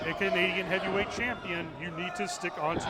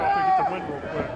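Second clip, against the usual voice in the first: excited; 13 semitones higher, 6 dB louder, much faster than usual. Real, not acted. Commentator hyping fast finish.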